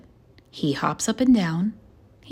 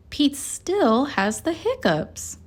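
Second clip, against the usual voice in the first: usual speaking voice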